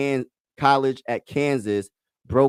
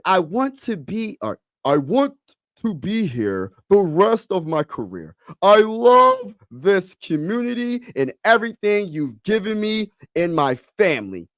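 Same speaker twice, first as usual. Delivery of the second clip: Joel Embiid voice; 6 semitones higher than usual. Acted. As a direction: draw out each phrase as an imitated athlete. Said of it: "put my Joel Embiid voice on".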